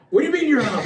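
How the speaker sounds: what do you mean, disbelieving; his partner quits, he protests in disbelief; incredulous. Retold or imitated. Retold disbelief at partner quitting.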